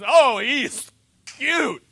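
loud, high, drawn-out fake cooing; hammed-up, insincere coo; fake gushing. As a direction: stretch it out in mock adoration of a baby. Mock gushing over an ugly baby.

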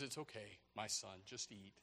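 low, hushed reassurance; the Lord. Acted. God calming his son as enemies roar, quiet reassurance. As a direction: soothe softly after the uproar. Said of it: "And the Lord says".

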